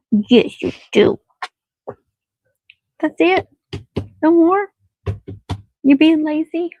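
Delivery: high, loud doggy talk. Real, not acted. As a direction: coo at the dog, high and loud. Baby talk to the dog.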